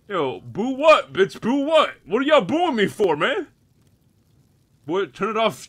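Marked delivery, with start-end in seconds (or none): none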